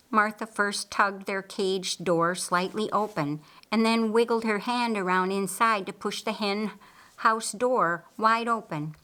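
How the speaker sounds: usual speaking voice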